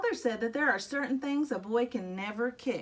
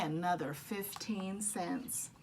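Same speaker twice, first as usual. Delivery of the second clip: mock mournful; 5 semitones lower, 8 dB quieter, slower than usual. A narrator's reading. Mournful refrain over lost money.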